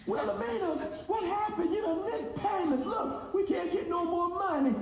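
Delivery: high, soft falsetto scold; mock scolding. Customer scolding his failed company in a high mock voice. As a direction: chide her like a fed-up auntie.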